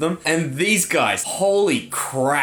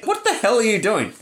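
mock indignation, pitched up; lightly voiced irritated older coworker, higher; annoyed old person; 8 semitones higher than usual. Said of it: "annoy old people at work".

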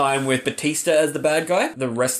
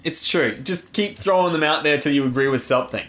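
ordinary talk, nothing marked

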